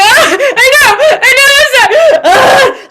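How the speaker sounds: high, loud crying and laughing; retold breakdown, laughing while crying; breaking down. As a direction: crack and sob through the words. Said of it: "I was like".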